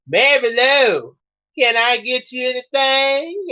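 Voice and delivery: high, sugary-sweet mock waitressing; slow, high-pitched mimicry, sweet as can be; sweet mimicking voice. A narrator's reading. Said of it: "said in a real sweet voice".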